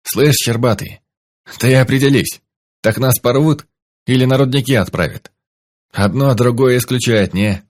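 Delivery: mocking taunt. Khorek taunting hostage.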